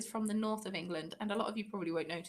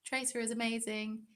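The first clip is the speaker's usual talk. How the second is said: usual speaking voice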